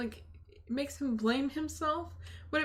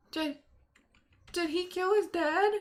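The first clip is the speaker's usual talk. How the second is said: real shock; 5 semitones higher than usual. Real, not acted. Shocked reaction to the story.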